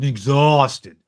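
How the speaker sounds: "exhausted" stretched slow in a mock New York accent. Cohost mocking the candidate's delivery with a New York accent. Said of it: "He needs to do a New York accent".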